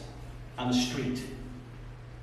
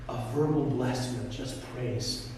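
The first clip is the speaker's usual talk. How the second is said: ordinary delivery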